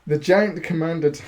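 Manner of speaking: words broken by a laugh. Real, not acted. Reader cracks up mid-sentence at his own reading, laughing. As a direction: read through a fit of giggles. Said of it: "what am I doing?".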